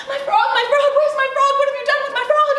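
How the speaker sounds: high, loud panicked demand; frantic. A narrator's reading. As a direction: cry out for a lost pet, very high. Princess frantic over missing frog.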